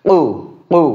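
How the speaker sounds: কু from the very back of the tongue; কু with a deep uvular q; deep q sound. Shown as a demonstration. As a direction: push কু out from the throat. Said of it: "জিহ্বার একদম গোড়াটা উপরের দিকে লাগবে".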